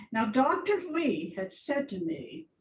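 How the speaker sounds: normal talking voice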